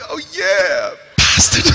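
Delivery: high, loud mock-bitter "bastard"; mock jealous. Pretending to begrudge the friend who got the role, loud mock curse. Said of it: "So I was like".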